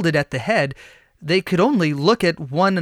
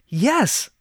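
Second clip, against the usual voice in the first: high, lifted yes; joyful yes; 7 semitones higher than usual. A narrator's reading. Women agreeing together, mild joyful shout. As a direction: burst out with joy. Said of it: "they shouted together".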